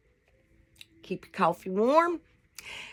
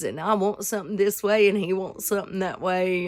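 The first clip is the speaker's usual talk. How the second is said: usual speaking voice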